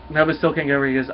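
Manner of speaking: ordinary talk, nothing marked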